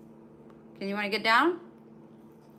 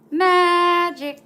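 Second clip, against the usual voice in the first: excited exclaim; 9 semitones higher, 14 dB louder than usual. Real, not acted. Amazed at dog's healed skin.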